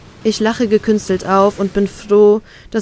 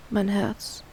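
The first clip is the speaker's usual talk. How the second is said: usual speaking voice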